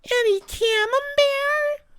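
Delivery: very high, slow, tiny mouse squeak; sky-high imitated mouse voice, drawn out. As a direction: squeak out a question like a little cartoon mouse. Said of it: "And the mouse goes".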